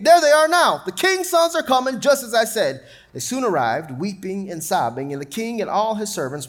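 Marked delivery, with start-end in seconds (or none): 0.0-2.8 high, loud eager pointing out; excited announcing. Acted. Jonadab telling the king his sons are coming, high and excited.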